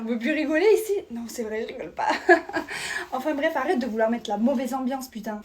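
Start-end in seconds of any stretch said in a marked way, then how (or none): 2.1-3.1 short breathy mocking laugh; airy little scoffing laugh. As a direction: laugh it off at her expense. Mocking after a joke.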